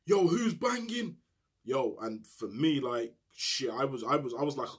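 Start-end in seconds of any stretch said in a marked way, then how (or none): 0.0-1.2 window shout. Retold or imitated. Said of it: "start shouting out the window".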